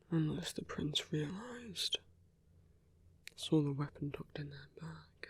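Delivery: soft, hushed muttering under the breath; quiet private murmur; musing to oneself. Acted. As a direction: think aloud, barely voiced. Muses alone before the meeting.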